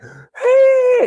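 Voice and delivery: high, loud whoop of "hey"; excited rider. Retold or imitated. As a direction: imitate a thrilled rider calling out. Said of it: "he's all excited".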